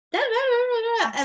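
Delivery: high, loud nonsense syllables; hammed-up jabbering sounds; mock nervous babble. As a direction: babble like you can't stop talking. Imitating own nervous rambling.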